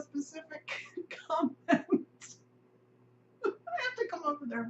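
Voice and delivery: real laughter breaking up the words; cracking up. Real, not acted. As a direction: try to finish through helpless giggles. Cracks up at own overthinking.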